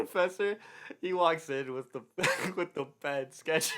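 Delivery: words broken by laughter; giggling through the punchline; laughing. Real, not acted. Telling a friend about the professor's sketch, laughing.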